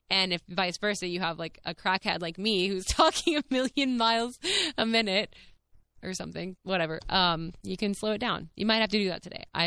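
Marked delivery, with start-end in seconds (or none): none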